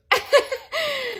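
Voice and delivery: high, put-on awkward laugh. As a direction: laugh to cover not understanding. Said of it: "tout ce que j'ai trouvé à faire". (rire de malaise, aigu et forcé)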